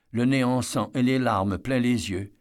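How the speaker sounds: plain reading voice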